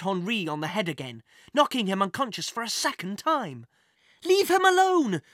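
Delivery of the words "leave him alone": high, loud desperate scream. A narrator's reading. Jack screaming at the thief attacking the inspector, high and desperate. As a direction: scream it as you leap on him. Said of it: "screamed Jack".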